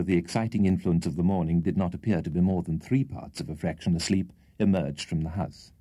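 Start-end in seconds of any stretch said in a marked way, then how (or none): none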